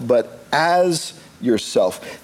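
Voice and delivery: "as" stretched slow and set apart by a pause; stress on AS. Real, not acted. Preacher explaining a command to love, stress on "as". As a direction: lean long on "as", then pause. Contrasts "as" with "means to.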